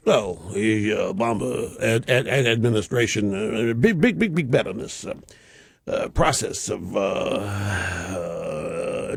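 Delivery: low, hesitant stammering mock of an official; mocking intellectual speak. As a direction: lightly send up a fumbling bureaucrat. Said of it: "classic intellectual speak".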